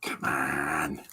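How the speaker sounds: low, soft, tired scoff; a quiet echo of the debate put-down; weary come on. Retold or imitated. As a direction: sigh it to yourself. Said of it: "that debate".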